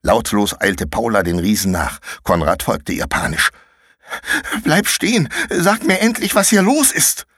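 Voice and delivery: lightly voiced urgent children; urgent kids' calls. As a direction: hurry the other one along. Paula and Konrad urgent calls.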